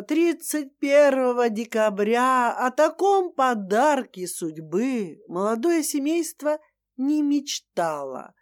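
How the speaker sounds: ordinary delivery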